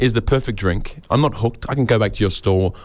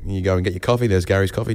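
normal talking voice

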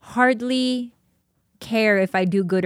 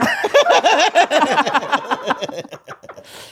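high helpless cackle; long loud laugh; 7 semitones higher, 6 dB louder than usual. Real, not acted. Laughing at his old breakup tagline, long loud laugh. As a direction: laugh till it fades.

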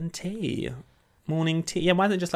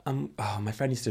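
ordinary delivery

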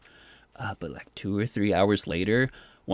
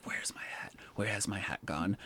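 fretful whisper under the breath; anxious whisper; 11 dB quieter than usual. Retold or imitated. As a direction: whisper it to yourself, hunting for the lost hat. Upset about the lost hat.

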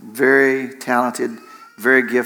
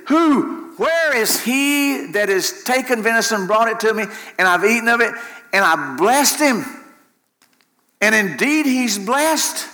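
trembling dismay; 8 semitones higher than usual. Acted. Isaac realizes he blessed another son, dramatic dismay. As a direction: stretch out the shocked question, acted out. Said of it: "trembled exceedingly".

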